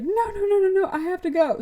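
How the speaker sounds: a squeaky frantic refusal; retold girl begging to leave, frantic; mock panic.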